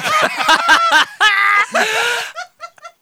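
high shrieking laugh; bursting into a shriek of laughter; loud laughing shout. Real, not acted.

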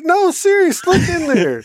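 high, eager insistence; insisting. Retold or imitated. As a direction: push him to look, grinning. Insisting to dad, laughing.

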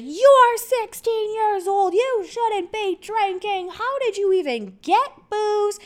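high, loud imitation of an angry mother; mom freaking out. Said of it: "Freaking out on me".

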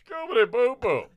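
high, loud cartoon dog howl; Scooby-Doo voice. The girl answering in a cartoon dog voice, high. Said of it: "she looks into my eyes and goes".

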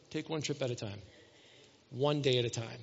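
soft Jesus voice. Retold or imitated. Jesus telling the worried shopper to take one trip, softly. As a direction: say it gently, like a reassurance.

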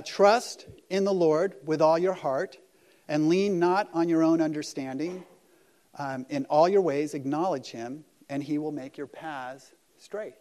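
slow, loud, solemn reading of the verse; scripture reading. Shown as a demonstration. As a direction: read each word of the verse out carefully and loudly. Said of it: "It says this".

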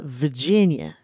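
Virginia drawn into a slurred "Vuh-jin-ya"; Virginia accent. Retold or imitated. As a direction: say Virginia the local way, first r dropped. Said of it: "they have such a different accent".

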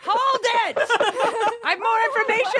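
excited shout. Pretend. Shouts to stop plane, laughing.

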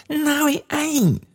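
high, drawn-out gleeful cry; burst of delight. A narrator's reading. Daughter thrilled at father's wrong guess, delighted cry. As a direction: squeal the denial with delight. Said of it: "in a burst of delight".

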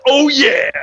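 retold whoop as the pile driver lands; triumphant whoop. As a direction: crow it as the move lands.

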